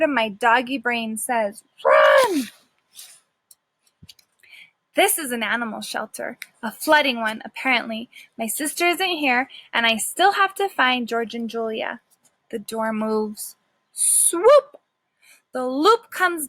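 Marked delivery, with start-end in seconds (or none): 1.8-2.5 a sudden loud "Run!"; urgent shout. Acted. The dog's instinct yells at him to flee, loud. 13.9-14.8 high, loud swooping sound word; sudden swoop. A narrator's reading. Narrating the lasso snapping down, high and loud.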